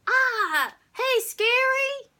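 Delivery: very high, loud cartoon welcome; over-the-top shrieked greeting, drawn out; the Wolf. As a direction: usher guests in like a clown. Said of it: "like a human cartoon called the Wolf".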